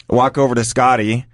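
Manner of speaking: usual speaking voice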